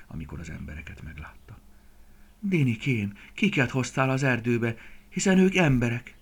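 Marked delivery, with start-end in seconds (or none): 2.4-6.1 raised, louder worried question; alarmed reproach. A narrator's reading. Frightened mother deer seeing humans with her son, alarmed. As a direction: question your child in alarm.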